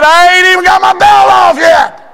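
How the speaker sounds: high, loud shout of a father; dad's shout. Retold or imitated. Father shouting at his son in the woodshed. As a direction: shout it high as the angry dad. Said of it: "He said".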